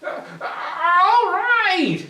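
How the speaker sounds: high, slow grudging goblin; a goblin giving in; reluctant goblin. Acted. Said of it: "said the goblin".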